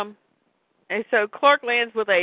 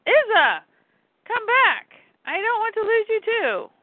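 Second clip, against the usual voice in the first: put-on much higher, held kid voice; high child's call after a runaway; crying-out child; 14 semitones higher, 3 dB louder than usual. Kid calling the dog back, high pleading child voice. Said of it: "there's this kid running".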